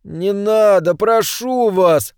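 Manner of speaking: high, drawn-out plea; begging. A narrator's reading. Orange man to the man with the hammer, begging. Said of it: "взмолился он".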